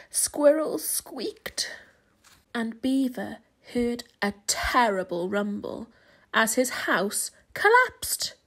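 usual speaking voice

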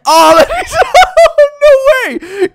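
high, loud laughing disbelief; laughing shout. Real, not acted. Amazed during race.

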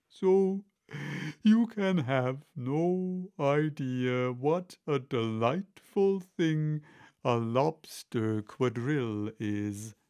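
slow, drawn-out storybook creature; Mock Turtle's ponderous voice. Mock Turtle explaining the lobster quadrille to Alice, slow and ponderous. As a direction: stretch out each phrase like a wistful old storyteller.

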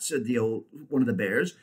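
plain reading voice

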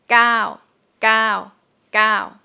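gao with a long vowel. Shown as a demonstration. Teacher modelling the long vowel for students. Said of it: "is long".

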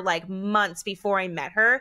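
normal talking voice

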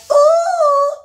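amazed ooh. Acted. Amazed at growing legs.

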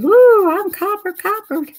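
high squeaky alien. Pretend. Coloring an alien, a high squeaky alien voice. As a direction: squeal it like a giddy little alien. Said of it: "This alien says".

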